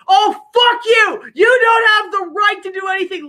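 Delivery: hammed-up drunken shout at the TV. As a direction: bellow it like a drunk guy ranting. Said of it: "And they're like".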